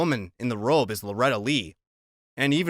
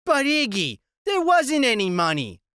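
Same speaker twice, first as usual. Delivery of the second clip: tough woman voice; 11 semitones higher, 4 dB louder than usual. Acted. Loretta pleading.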